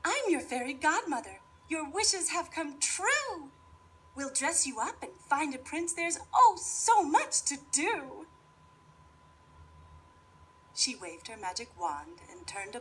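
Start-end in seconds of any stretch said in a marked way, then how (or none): 0.1-8.3 fairy godmother voice. Acted. Fairy godmother introduces herself.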